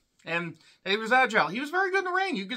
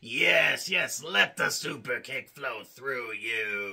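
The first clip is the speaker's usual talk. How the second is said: dark side tempter; 4 semitones lower, slower than usual. Pretend. Wrestling reviewer voicing a dark lord, low and sinister. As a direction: tempt him toward evil, slow and hushed.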